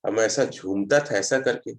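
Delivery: normal talking voice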